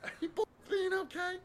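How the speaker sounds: very high fretting mother; worried mom voice. Retold or imitated. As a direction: ask it like a fussing mom.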